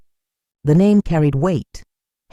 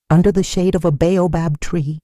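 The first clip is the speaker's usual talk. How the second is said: ordinary delivery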